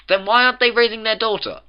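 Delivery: high, loud challenging question; exasperated demand. A narrator's reading. Twilight interrupts the narration about rumours, exasperated demand. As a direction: cut in and demand an answer.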